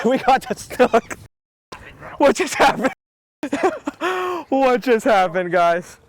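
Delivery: high laughing disbelief; laughing with excitement. Real, not acted. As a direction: laugh it out, thrilled. Laughing after landing the fish.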